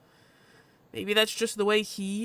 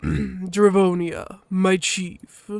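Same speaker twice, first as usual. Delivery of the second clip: slow, louder, weighty greeting; gruff husky Loken; 7 dB louder, much slower than usual. Acted. Loken greets his chief, formal and gruff. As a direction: greet the chief slowly and solemnly.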